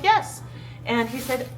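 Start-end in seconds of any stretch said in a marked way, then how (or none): 0.0-0.4 high, louder eager yes. Retold or imitated. To the polite clerk, an eager high yes. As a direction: jump at the offer.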